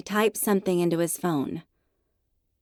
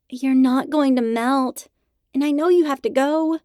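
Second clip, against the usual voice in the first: coaxing high voice; 7 semitones higher, 5 dB louder than usual. Acted. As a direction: coax the dog outside. Coaxing the dog.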